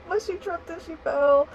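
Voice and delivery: high, loud, laughter-choked words; laughing while talking. Real, not acted. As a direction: get the words out through laughter. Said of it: "I laugh".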